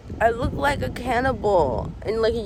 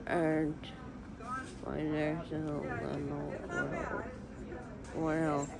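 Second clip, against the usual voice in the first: soft, numb-mouthed and drowsy; groggy from anesthesia; 3 semitones lower, 9 dB quieter than usual. Real, not acted. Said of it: "my tongue feels so big in my mouth".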